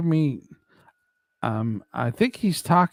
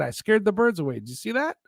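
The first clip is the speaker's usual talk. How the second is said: ordinary delivery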